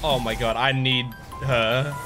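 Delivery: ordinary talk, nothing marked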